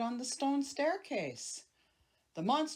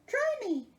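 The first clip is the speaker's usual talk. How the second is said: high squeaky monster voice; 5 dB louder than usual. Acted. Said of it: "he said".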